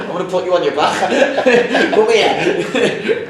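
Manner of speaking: high, loud taunt breaking into laughter; playful shout through laughter; laughing mock taunt. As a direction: crow it at a sparring partner, laughing hard. Acting out taunting sparring partner, laughing.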